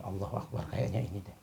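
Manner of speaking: soft hushed realisation; quiet awe. Acted. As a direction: breathe it out in wonder. Sheikh realising softly. (takjub dengan suara pelan)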